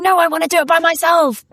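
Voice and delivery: put-on high, fast, stubborn little-kid voice; toddler voice. Toddler refusing help with laces, high stubborn whine. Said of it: "And they're going".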